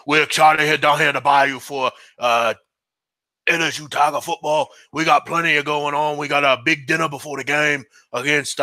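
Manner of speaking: impression of a Bayou football coach; rambling Louisiana coach talk; Ed Orgeron. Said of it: "Ed Orgeron".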